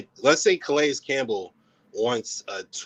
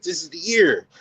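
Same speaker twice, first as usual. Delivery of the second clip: retold hopeful excitement, pitched up; excited proclamation, recalled; 6 semitones higher, 4 dB louder than usual. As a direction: relive a fan's high-pitched burst of belief. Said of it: "I was excited and I was like".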